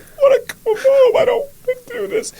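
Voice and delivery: high, loud wailing sob, slowed down; imitating crying. Husband on the phone to his wife, high wailing sob. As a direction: bawl it like a six-year-old boy. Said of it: "that's literally how I was".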